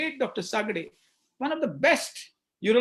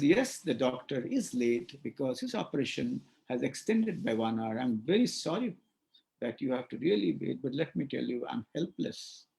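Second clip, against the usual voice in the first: soft front office; 8 semitones lower, 5 dB quieter than usual. Shown as a demonstration. Receptionist calmly apologising to waiting patient. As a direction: lower and softer, apologetic. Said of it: "feeling phrase".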